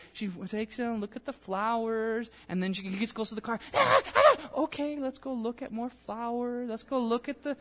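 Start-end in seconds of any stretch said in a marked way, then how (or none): 1.0-2.3 coaxing mom. Acted. Mom coaxing toddler toward car. 3.7-7.6 shrill high yelp then sing-song higher voice; anxious coaxing mom. Acted. Mother distracting her screaming toddler with flowers, high and sing-song.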